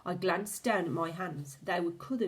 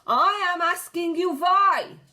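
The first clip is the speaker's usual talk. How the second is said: high, very loud witch scream; 11 semitones higher, 11 dB louder than usual. A narrator's reading. The Grand High Witch demands an answer, screaming. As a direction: scream the question at the hall. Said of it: "she screamed".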